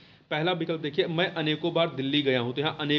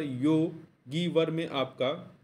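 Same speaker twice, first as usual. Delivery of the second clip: slow, stretched; much slower than usual. Shown as a demonstration. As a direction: stretch Yogi वर for the class. (धीमे, खींचकर)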